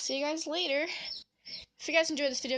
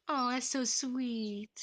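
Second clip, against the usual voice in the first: drawn-out tender oh, slow; slow melting coo; 2 semitones higher, much slower than usual. Real, not acted. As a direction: melt over the cute moment, slowly. Melting over bird's kiss.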